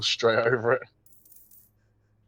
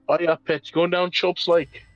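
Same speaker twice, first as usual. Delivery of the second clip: loud, higher Yorkshire impression; Yorkshire accent; 7 semitones higher, 5 dB louder than usual. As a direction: call it out in broad Yorkshire.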